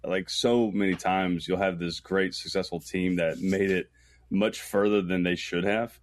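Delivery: ordinary delivery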